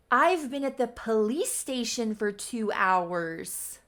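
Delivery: slow, loud, exasperated retelling; freaking out. Retold or imitated. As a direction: stretch out the complaint, punching it loudly. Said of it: "She is absolutely freaking out".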